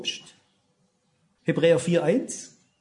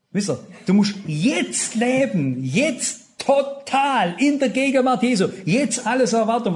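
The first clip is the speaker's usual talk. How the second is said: urgent preaching; 6 semitones higher, 3 dB louder than usual. Acted. Preacher pleading with the congregation to live in the present, urgent. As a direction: plead with them to live now.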